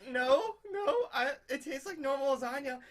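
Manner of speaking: high, laughing mock girlfriend denial. Girlfriend insists the lasagna tastes normal, high and giggly. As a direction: squeak it out through laughter like his unsuspecting girlfriend. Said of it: "she's like".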